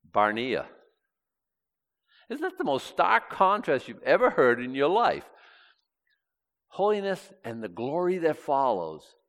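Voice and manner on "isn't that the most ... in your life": ordinary delivery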